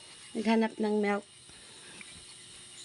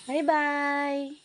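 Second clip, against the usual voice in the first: cooing goodbye; 5 semitones higher, 4 dB louder than usual. Real, not acted. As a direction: stretch a high little goodbye.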